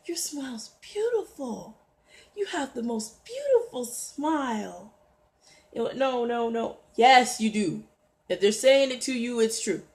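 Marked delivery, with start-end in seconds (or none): 0.0-4.9 high, soft, cooing admiration; admiring compliment. Acted. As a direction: gush softly as if admiring someone. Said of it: "somebody else might say".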